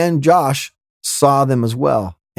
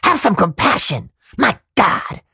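whisper shout; 3 semitones higher than usual. Retold or imitated. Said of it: "kinda whisper shouts".

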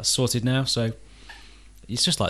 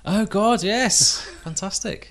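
excited; 11 semitones higher, 5 dB louder, much slower than usual. Real, not acted. Reacting to big moving news.

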